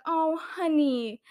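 imitating syrupy pity, high and drawn out; mock condescending. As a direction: coo it slowly, talking down as if to a child. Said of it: "in a condescending way".

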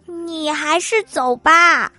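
a lightly voiced reluctant kid; reluctant child. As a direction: drag it out, torn over the doll. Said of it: "面有难色地说".

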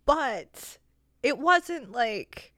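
ordinary talk, nothing marked

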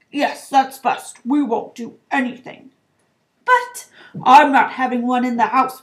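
loud, emphatic decree; blustering, resolute uncle; Uncle Vernon, blustering. A narrator's reading. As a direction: lay down the law to your wife. Narrator voices Uncle Vernon deciding.

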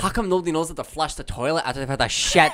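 loud, high put-on ranting voice; mock outrage, loudly hammed up. Host doing a bit about the toilet, mock outraged rant.

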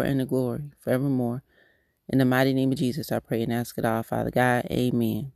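soft, unhurried prayer voice; spoken prayer. Acted. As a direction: pray it quietly and earnestly, lifting each phrase to God. Recites the Lord's Prayer.

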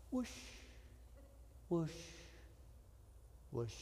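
hushed swishing water imitation; soft whooshes. Swimmer gliding on his back, softly whooshing. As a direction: whoosh gently with long pauses.

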